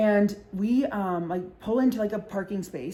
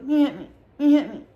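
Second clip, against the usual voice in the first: louder, higher mock drunk wail; drunk accusation; 5 semitones higher, 6 dB louder than usual.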